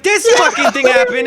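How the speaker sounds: high, loud mock outrage; worked-up rant. Student ranting to friend about Twitter, mock outrage. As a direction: rant it like it's a huge deal.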